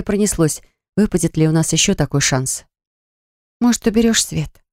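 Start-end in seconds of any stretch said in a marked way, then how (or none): 3.6-4.6 higher, pleading little ask; plaintive. A narrator's reading. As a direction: ask shyly, a touch plaintive. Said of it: "жалобно попросила я".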